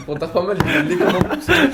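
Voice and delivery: normal talking voice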